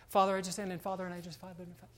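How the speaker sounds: rattled-off prayer trailing into a soft mumble; absent-minded, repetitive mumbled prayer, fading away; mock mindless praying. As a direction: imitate someone praying on autopilot. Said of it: "not even paying attention to what I'm saying".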